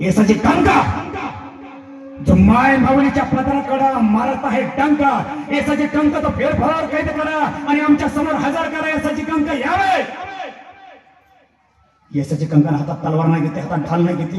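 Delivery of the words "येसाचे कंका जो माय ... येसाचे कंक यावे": slow, high commanding call; royal summons. Acted. King calls soldier Yesaji Kank, commanding slow shout. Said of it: "सरळ आवाज दिला".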